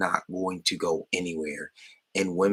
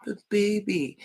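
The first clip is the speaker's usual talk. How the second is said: put-on high whiny "But baby"; high pleading mockery; mock whiny boyfriend; 13 semitones higher, slower than usual. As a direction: whine it like a needy boyfriend. Mocking the pandering boyfriend.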